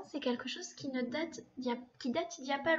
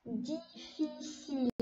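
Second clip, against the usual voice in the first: slow syllable reading; 3 semitones higher, much slower than usual. Shown as a demonstration. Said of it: "on dit le mot ensemble".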